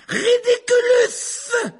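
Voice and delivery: high, loud, drawn-out yell; yelled spell. A narrator's reading. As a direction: scream out the incantation slowly. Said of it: "hurla Ron". (incantation hurlée, aiguë et étirée)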